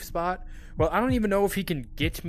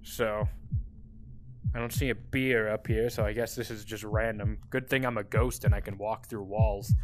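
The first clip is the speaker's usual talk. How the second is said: ordinary talk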